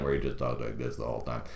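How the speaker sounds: low, soft deadpan guide. Retold or imitated. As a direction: imitate a tour guide's dry monotone. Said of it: "The guy we had was so deadpan".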